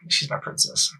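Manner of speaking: ordinary talk, nothing marked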